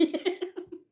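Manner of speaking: high, soft giggle; chuckling. Real, not acted. Reviewer joking about nonstop serial crying, giggling. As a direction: giggle lightly at your own joke.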